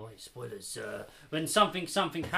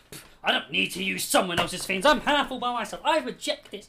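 loud, haughty rejection; proud refusal; 3 semitones higher, 9 dB louder than usual. Pretend. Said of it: "Imagine he goes".

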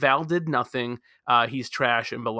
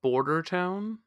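Border Town? repeated higher, pitched up as a question; clearer repeat; 7 semitones higher, slower than usual. Real, not acted. Guessing a show title again when friend asks, higher. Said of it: "Say it again, [name]".

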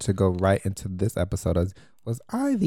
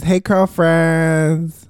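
show greeting; 7 semitones higher, 8 dB louder, much slower than usual. Acted. Podcast catchphrase greeting.

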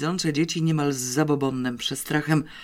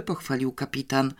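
ordinary delivery, nothing marked

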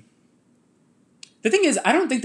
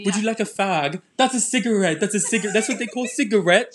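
higher-pitched put-on British; insistent mock British, pitched up; British accent; 7 semitones higher, 3 dB louder than usual. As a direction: keep up a fake British accent while protesting. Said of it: "Let us hear your British accent".

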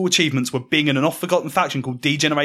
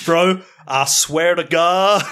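put-on thick New York accent, slow and drawn out; 4 dB louder, much slower than usual. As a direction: lay the New York accent on heavy, slowly. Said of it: "the most New York accent you have ever muttered".

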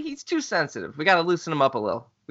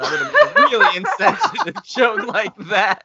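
laughing; 13 semitones higher, 6 dB louder than usual. Real, not acted. Laughing through own joke.

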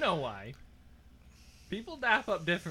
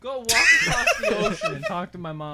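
shouting match; 5 semitones higher, 7 dB louder than usual. Pretend. Drunk banter argument.

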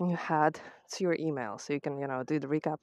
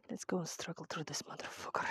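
whisper; 12 dB quieter than usual. Real, not acted. Climbing the dunes.

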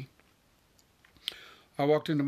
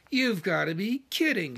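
high-pitched, indignant disbelief; incredulous outrage; 6 semitones higher than usual. A narrator's reading. Wife appalled at what scientists missed, incredulous outrage. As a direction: protest in disbelief, voice swinging up.

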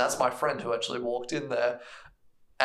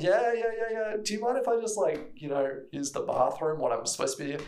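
much higher, rushed agreeing; mock impatient brush-off; 7 semitones higher than usual. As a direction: humour him just to get past. He brushes the attendant off.

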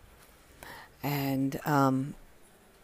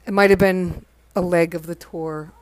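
ordinary delivery

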